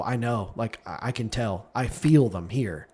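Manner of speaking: soft, low-key imitation of wary certainty; hushed retold quote. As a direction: quote him softly, sensing someone near. Retold husband sensing hidden assassins nearby.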